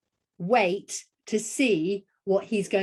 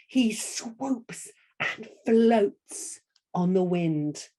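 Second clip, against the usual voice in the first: slow, "swoops" and "floats" drawn out; slow gliding storyteller delivery; swooping and floating; much slower than usual. Shown as a demonstration. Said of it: "I'm gonna say it like that".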